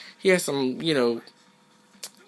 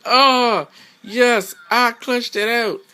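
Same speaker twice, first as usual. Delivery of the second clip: higher, louder gleeful cheer; mock opponent celebrating; 7 semitones higher, 5 dB louder, much slower than usual. Gamer mocking opponent's clutch win, higher gleeful cheer. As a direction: gloat over the clutch. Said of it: "He's like".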